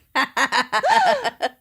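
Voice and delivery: high nervous giggle; nervous laugh. Real, not acted.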